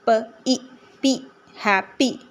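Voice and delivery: प, ई blended into पी, then हैप्पी; sounded out. Shown as a demonstration. Teacher showing the ee sound in happy, sounded out. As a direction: say प and ई apart, then पी, हैप्पी in beats. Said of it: "Y की ई sound निकल रही है".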